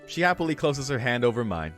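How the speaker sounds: normal talking voice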